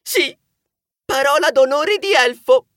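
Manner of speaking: high, loud little elf voice swearing; a small elf swearing his word. Acted. Elf promising friendship in a high, loud voice.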